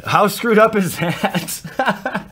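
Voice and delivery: high, loud, slow exclamation; amused disbelief. Real, not acted. Card opener mocking his own lucky expectations, amused disbelief. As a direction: exclaim it, drawn out, on the edge of laughing.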